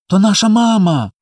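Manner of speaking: put-on high, delighted little-boy cry; overjoyed child. A narrator's reading. Small boy spots mama in the stars, overjoyed. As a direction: burst out with joy, pitched high. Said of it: "зрадів Коля".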